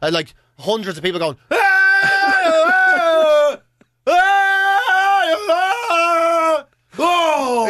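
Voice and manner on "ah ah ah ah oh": loud high wailing cries of a crowd; mock crowd wailing. Retelling a crowd crying out, loud wailing. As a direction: wail out like hundreds of hurting people.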